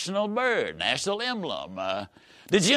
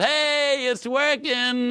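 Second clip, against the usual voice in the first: bright, much higher, pleased; cheerful doctor; 9 semitones higher, much slower than usual. Retold or imitated. Doctor greets a returning patient, bright and delighted. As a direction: sound the doctor's happy surprise. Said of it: "she looks at you and smiles and says".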